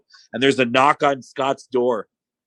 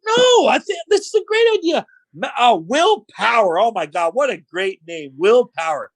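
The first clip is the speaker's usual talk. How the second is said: sarcastic; 9 semitones higher than usual. Retold or imitated. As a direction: gush over the name with fake delight. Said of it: "And he's super sarcastic".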